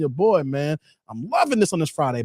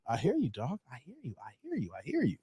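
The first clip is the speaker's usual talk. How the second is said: each line softer, trailing off; fading repeat; 4 semitones lower, 13 dB quieter than usual. Real, not acted. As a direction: let it fade away, repeat by repeat. Repeats phrase, trailing away.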